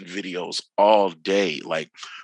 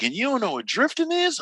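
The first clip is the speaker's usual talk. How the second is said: much higher, acted-out disbelief; incredulous question; 12 semitones higher than usual. Instructor stunned that a rally fan knows nothing, incredulous. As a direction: imitate an instructor who can't believe it.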